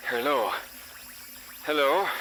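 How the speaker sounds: frantic calling out. A narrator's reading. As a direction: call out frantically, climbing on the repeat. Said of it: "I heard a frantic voice calling out".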